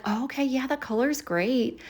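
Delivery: lightly voicing a bored guest; unimpressed mimic. Mother-in-law touring the house, quiet half-hearted praise. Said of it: "She's like".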